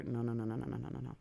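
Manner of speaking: normal talking voice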